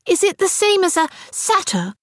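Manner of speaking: put-on eager young girl asking; little girl voice. A narrator's reading. Frances, youngest sister.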